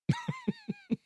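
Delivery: a sudden high squeal of a laugh; quick high-pitched laugh. Real, not acted. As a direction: burst into a high giggle. Laughs at the subtitle joke.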